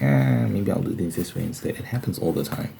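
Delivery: ordinary delivery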